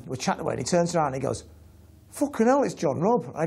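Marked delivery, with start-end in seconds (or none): 2.1-3.2 surprised recognition. Retold or imitated. Retold surprised recognition in taxi.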